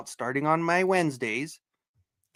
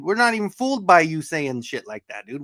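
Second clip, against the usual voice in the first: ordinary talk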